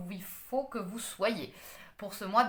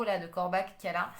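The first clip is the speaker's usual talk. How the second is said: ordinary delivery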